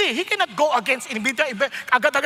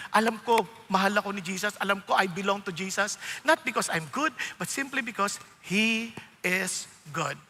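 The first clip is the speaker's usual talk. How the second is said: quiet assurance; 8 semitones lower, 6 dB quieter than usual. Retold or imitated. Voicing a believer's quiet confidence.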